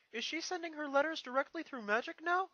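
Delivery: put-on high, loud young dragon voice; Spike's voice. Young dragon asks Twilight about the letter, high and eager.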